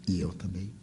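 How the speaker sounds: usual speaking voice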